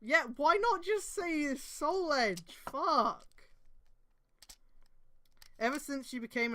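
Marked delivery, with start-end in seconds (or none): 0.0-2.4 drawn-out, high, loud cry of frustration; exasperated cry. Real, not acted. Reader despairing at the fanfic's wording, slow, loud, wailing. 2.7-3.3 frustrated curse. Real, not acted. Frustrated outburst after the complaint.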